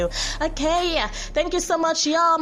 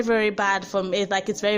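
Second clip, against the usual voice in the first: usual speaking voice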